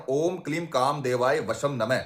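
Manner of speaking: slow, measured spoken reading of a mantra; spoken mantra, not chanted. Shown as a demonstration. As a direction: read the mantra out slowly, word by word. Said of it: "जैसे कि आप screen पर देख पा रहे हो".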